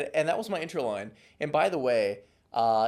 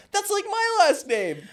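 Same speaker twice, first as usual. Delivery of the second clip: put-on girly voice; 6 dB louder, slower than usual. Quoted girl, squealing high.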